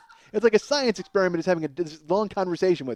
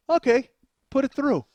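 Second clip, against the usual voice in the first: feigned compliance; 9 semitones higher, 3 dB louder than usual. Acted. Feigned agreement to lure stranger.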